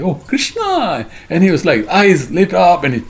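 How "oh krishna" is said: softly exclaimed, drawn-out joyful greeting; higher, lingering happy recognition; overjoyed. Retold or imitated. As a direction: cry the name with slow, high delight. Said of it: "He became very happy".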